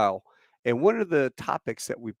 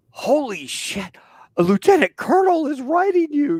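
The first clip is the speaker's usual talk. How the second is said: amazed recruits; 6 dB louder than usual. Retold or imitated. Recruits amazed at a letter from a lieutenant colonel, high excited exclaim. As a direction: burst out like stunned boot camp buddies. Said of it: "they're like".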